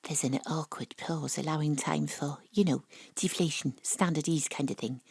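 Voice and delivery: soft, half-whispered narration; hushed aside. Acted. Narrator murmurs the awkward pause, soft and hushed. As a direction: tell it under your breath.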